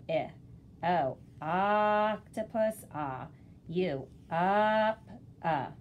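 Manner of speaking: slow, drawn-out vowel sounds; vowel chart drill. Shown as a demonstration. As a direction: sound out each vowel slowly. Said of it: "vowel chart that ... you can practice with".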